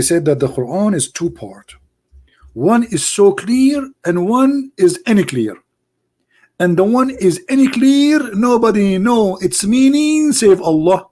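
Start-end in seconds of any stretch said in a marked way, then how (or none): 2.5-11.1 high, slow mock preacher. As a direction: sneer it out like a pompous preacher. Mocking quote of Muhammad.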